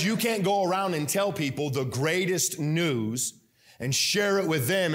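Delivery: normal talking voice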